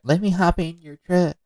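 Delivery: high-pitched mock girl voice; hammed-up girlish invitation, pitched well up; imitated admiring girl. Coach replaying the girl's offer, high mock gushing. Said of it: "She goes".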